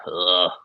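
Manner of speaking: low groan of reluctance; acted-out weary "ugh"; exasperated groan. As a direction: groan at having to bother. Weary reaction to principal's imagined scolding.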